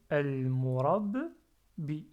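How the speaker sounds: "el murab" fort et lent, pause, puis "bi"; séparer nettement "el murab" de "bi"; décomposé. Shown as a demonstration. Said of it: "la barrière entre les deux syllabes". (« el murab… bi » en deux morceaux)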